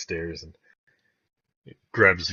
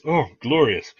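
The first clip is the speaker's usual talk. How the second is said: raised, loud exclamation of delight; Nighthill, delighted; 7 semitones higher, 7 dB louder than usual. Acted. Nighthill hears the party has returned, delighted exclamation. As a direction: welcome the good news aloud. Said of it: "Nighthill says".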